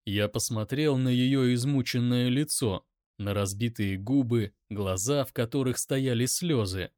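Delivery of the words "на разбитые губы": ordinary delivery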